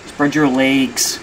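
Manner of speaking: slow, loud "legs" drawn out as "laygs"; hammed-up, stretched "laygs" for "legs"; mock regional pronunciation. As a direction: exaggerate "legs" with a long AY. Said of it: "Who the fuck says it like that".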